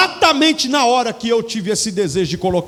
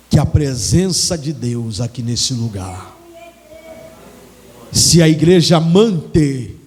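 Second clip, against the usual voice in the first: slow, weighty sermon declaration; slow, low preaching; 5 semitones lower, slower than usual. Acted. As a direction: proclaim it slowly to the congregation. (pregação lenta e solene)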